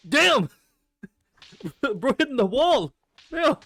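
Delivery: loud, high whoop of disbelief; amused shock. Real, not acted. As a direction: exclaim through a laugh, stunned.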